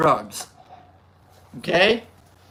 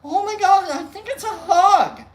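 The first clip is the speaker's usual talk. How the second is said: high wondering excitement; 9 semitones higher than usual. Retold or imitated. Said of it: "I'm like".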